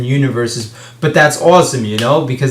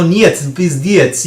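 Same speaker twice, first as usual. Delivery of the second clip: mocking a fake Russian accent, higher and slow; a jokey, exaggerated Russian accent; 5 semitones higher, slower than usual. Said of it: "try to do the fake Russian accent".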